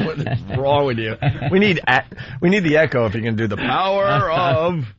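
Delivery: normal talking voice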